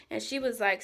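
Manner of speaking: ordinary talk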